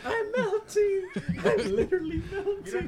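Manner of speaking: high squeal through laughter; helpless laughter. Real, not acted. Friend melting from the joke, laughing helplessly.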